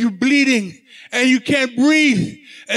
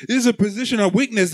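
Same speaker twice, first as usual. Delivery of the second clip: ordinary delivery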